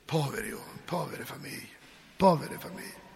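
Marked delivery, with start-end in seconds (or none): 0.1-1.7 much softer, sinking sorrow; hushed pity. Shown as a demonstration. Priest mourning workers' families, hushed and sorrowful. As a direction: let the pity drop almost to nothing. Preacher pities families without work.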